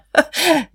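short, high-pitched acted laugh; loud laugh. A narrator's reading. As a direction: burst out in a brief, high laugh. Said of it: "Jetzt lachte Guste laut". (lautes, hohes Auflachen)

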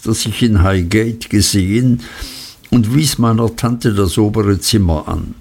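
ordinary delivery, nothing marked

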